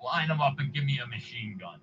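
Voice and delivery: mock grumble, held back; Stan Lee impression. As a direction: quietly imitate the fed-up star. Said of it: "compliment on my impression".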